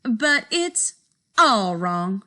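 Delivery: ordinary delivery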